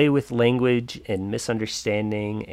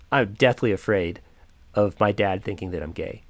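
ordinary talk

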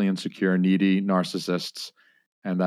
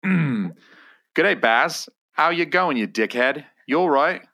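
higher-pitched, broad Australian bogan greeting; thick Australian; 6 semitones higher, much slower than usual. Acted. Host hamming a script to his mate Baz, thick Australian. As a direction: lay on the thickest Aussie accent you can. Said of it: "thickest, most unintelligible Australian".

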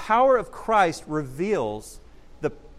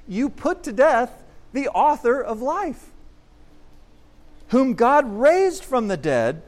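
slower, higher, louder declaration; emphatic proclamation; 6 semitones higher, 5 dB louder, slower than usual. Acted. Preacher re-reading Peter's charge, slow and emphatic. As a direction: lean into each word as an accusation.

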